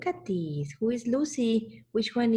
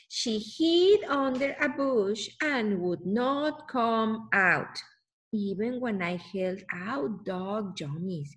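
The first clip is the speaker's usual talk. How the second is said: ordinary delivery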